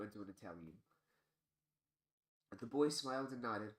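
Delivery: ordinary delivery, nothing marked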